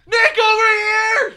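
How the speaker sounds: loud, high, slow call across a hall; hammed-up yell across the convention; mock yell. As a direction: holler his name across the room.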